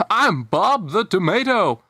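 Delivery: a hint of a cheery child introducing himself; young Bob. Young tomato introduces himself to the cucumber, lightly childlike. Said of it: "that's how they sound when they were younger".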